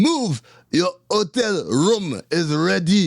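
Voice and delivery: a hint of a barking guard, slow on the rest; mock guard bark. Guard orders the prisoner out, a touch of mock barking.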